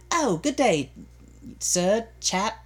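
mock British accent. Guessing what an accent means, mock British accent. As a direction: play a chappish British greeting. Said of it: "'cause you hear accent".

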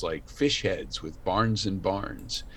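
ordinary talk

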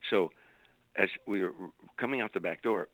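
ordinary talk

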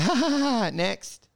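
mock a bored crowd's token laughter; fake polite laugh. Bored small crowd giving the comic token laughs, empty high ha-has.

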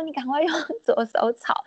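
quick unvoiced whispered urging. Mother-in-law telling her to switch hands, whispered. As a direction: hurry them along under your breath.

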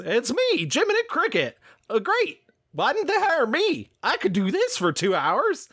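high squeaky cartoon cricket; Jiminy Cricket voice. Shown as a demonstration. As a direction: pitch way up, chirpy cartoon bug. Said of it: "Jiminy Cricket voice is this".

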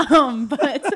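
laughing apologetically; loud laugh through the words. Real, not acted. Laughing at the noise.

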